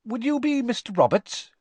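high, keen, friendly voice; an overeager wizard trying to act normal; eager Mr Weasley. Acted. Mr Weasley politely questioning the Muggle, high and keen.

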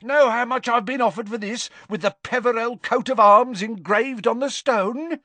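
fierce boasting. Acted. Father showing the official his ring, fiercely boasting. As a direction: flaunt the heirloom with fierce pride.

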